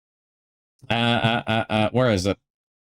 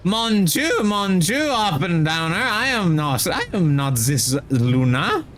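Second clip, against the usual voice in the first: put-on French accent; 4 semitones higher than usual. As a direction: ham up a French chef voice. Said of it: "a perfect Luna accent".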